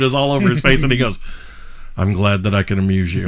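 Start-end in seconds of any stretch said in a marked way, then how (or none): 2.0-3.3 very low, quiet, deadpan resignation; imitating someone so done. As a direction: act utterly fed up, dropping the voice. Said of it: "he's just so done".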